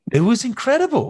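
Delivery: bursts up high and loud on incredible; thrilled. Real, not acted. Actor recalling his first big set, high thrilled burst. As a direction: gush it like you still can't believe it.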